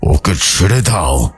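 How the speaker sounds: slow, eerie possessing spirit; hideous deep voice. Acted. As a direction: drag out the words like something inside her. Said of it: "গভীরভাবে বিভৎস এক কণ্ঠে বলছে". (মেয়ের ভেতর থেকে বলা ভয়ংকর আত্মা, ধীর বিকট গলায়)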